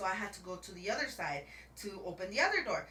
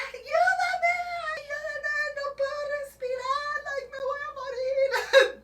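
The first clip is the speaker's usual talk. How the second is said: high, loud Spanish screaming for help; mock scream for help; 8 dB louder, slower than usual. Retelling her screams from the locked truck, in mock Spanish wailing. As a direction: wail it like you're trapped. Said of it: "So I started screaming".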